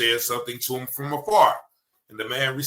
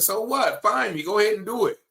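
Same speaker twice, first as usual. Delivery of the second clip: mock defiant, higher-pitched comeback; defiant retort; 9 semitones higher, 4 dB louder than usual. Man answering the officer's threat of a fine, defiant. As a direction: toss it back as a raised-pitch dare.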